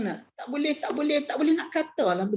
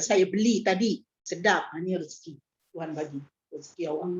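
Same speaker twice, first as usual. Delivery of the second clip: soft humble offer; 6 semitones lower, 4 dB quieter than usual. Shown as a demonstration. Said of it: "Rasalah sedap".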